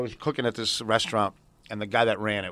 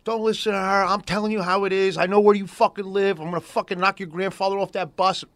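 angry threat; 7 semitones higher than usual. Retold or imitated. Ex-boyfriend threatening by phone.

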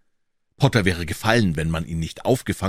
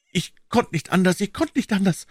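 high, anguished moan; 13 semitones higher than usual. A narrator's reading. Accused man explaining why he came back, high moaning. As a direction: groan it helplessly, pitched way up. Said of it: "stöhnte dieser".

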